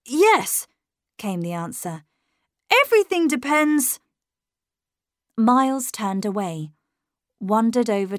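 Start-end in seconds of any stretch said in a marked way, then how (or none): 0.0-0.7 high, louder single word; insistent boy. A narrator's reading. Miles answering the governess, high insistent boy. 2.7-4.0 high, louder declaration; insistent boy. A narrator's reading. Miles answering the governess, high insistent boy.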